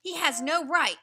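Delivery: angry. Acted. Father wolf angrily protesting the tiger's trespass. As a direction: bristle with anger at the intruder. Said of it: "Father Wolf began angrily".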